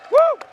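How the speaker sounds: a high, loud woo; excited cheer. Real, not acted. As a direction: whoop as the lot sells. Cheer as the lot sells.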